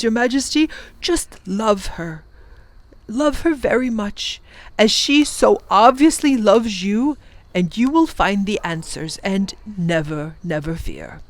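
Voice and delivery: gentle fatherly advice, slowing at the end; reassuring professor. Acted. The professor reassures the Wangdoodle about marriage, tender. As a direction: soothe a nervous friend.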